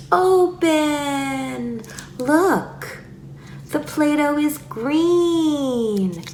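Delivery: put-on high, drawn-out delight for a toddler; slow, high child-directed exclaiming; stretched-out words. Shown as a demonstration. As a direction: stretch "Open" and "green" up high. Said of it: "stretch out words".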